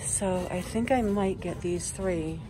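nasal, talking through a face mask; a bit nasal behind a mask. Real, not acted. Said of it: "Sorry if I sound nasally, guys. I have my mask on".

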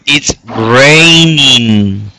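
It's raining stretched very slowly; painfully slow teacher model. Shown as a demonstration. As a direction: drag out raining for the student. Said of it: "Aquí ahora está".

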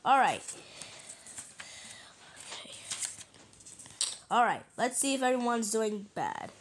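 usual speaking voice

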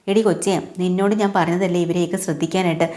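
plain reading voice

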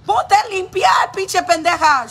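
high, slow, loud imitation of a scolding mother; angry mom yelling. Imitating her mom scolding her in Spanish, angry shout. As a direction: yell it like a furious mom ordering a cleanup. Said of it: "I feel like my mom, she would have".

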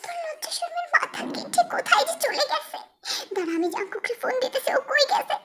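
put-on high-pitched scolding of a shameless child; scolding mother.

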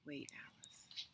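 soft, slow whisper; slow, breathy whisper to hold her back; hushed whisper. Acted. Jack whispers to Alice to wait.

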